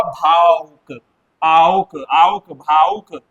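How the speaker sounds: भावुक slowed to show the आउक sound; slow split demo. Shown as a demonstration. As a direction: stretch out आउक, then भावुक. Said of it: "ये क्या हुआ?". (भावुक को धीरे से आउक की तरह तोड़कर बोलें)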